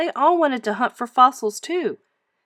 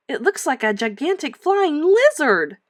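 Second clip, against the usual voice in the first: excited declaration; 6 semitones higher, 4 dB louder than usual. Acted. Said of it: "Mary declared".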